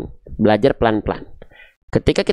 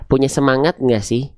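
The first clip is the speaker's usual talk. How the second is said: ordinary talk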